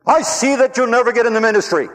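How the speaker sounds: loud, high, furious threat; furious professor. Retold or imitated. Professor raging at the student who quit, furious shout. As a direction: spit the warning out in rage. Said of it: "He got mad again, angry, furious".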